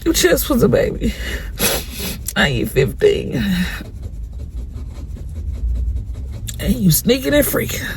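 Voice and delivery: high, quiet, tearful pleading; crying. Real, not acted. Mother crying over her son growing up, weepy. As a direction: sob through the words, voice breaking. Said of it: "I really wanna cry".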